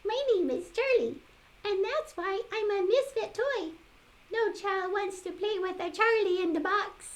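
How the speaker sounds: high, quick jack-in-the-box voice; high puppet voice. Acted. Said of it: "said the puppet".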